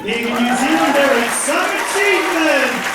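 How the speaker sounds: high, loud rousing boast; proud exclamation. Real, not acted. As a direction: crow it proudly over the applause. Proud boast to applauding audience.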